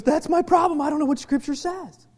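mildly exasperated imitation; retold protest. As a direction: play someone protesting they're lost. Acted quote of person protesting.

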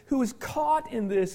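ordinary talk

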